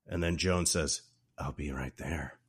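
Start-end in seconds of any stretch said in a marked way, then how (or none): 1.4-2.4 retold line, much softer and lower; hushed quote. Neighbor answering Jack on the phone, low and hushed. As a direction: drop low and quiet for the quote.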